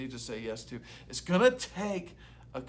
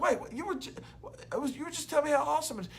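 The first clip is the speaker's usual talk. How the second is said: much higher, rushed bewildered babble; confused protest; 12 semitones higher, much faster than usual. Retold or imitated. Tricked sinner protesting to the enemy, high rushed confusion. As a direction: sputter in disbelief at the betrayal. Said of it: "And you're like".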